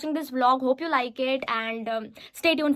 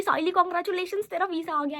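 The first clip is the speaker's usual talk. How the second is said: higher, louder happy shout of congratulations; best friend hugging her in the restaurant, excited; 6 semitones higher, 3 dB louder than usual. Retold or imitated. Friend hugs her, visa arrived.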